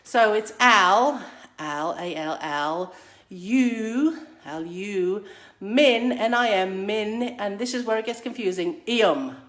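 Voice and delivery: aluminium broken into al, u, min, ium, loud teacher syllables; spelling out syllables. Shown as a demonstration. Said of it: "So you're ready".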